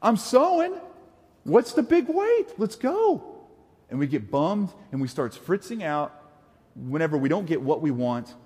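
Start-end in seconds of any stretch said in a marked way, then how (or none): 0.0-3.2 high, pleading mock whine; impatient whine. Carrying our impatience to Jesus, a high mock whine. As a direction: whine like a kid who won't wait. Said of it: "and we're like".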